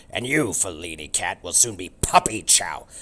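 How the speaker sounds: loud gruff dog threat; threatening dog. Acted. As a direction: menace the cat, growling. Turbo threatens cat.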